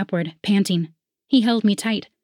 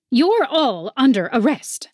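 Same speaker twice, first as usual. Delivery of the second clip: officer shouting; 6 semitones higher, 3 dB louder than usual. A narrator's reading. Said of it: "Iron Flutter shouted".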